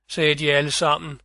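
plain reading voice

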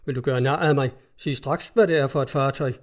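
ordinary delivery

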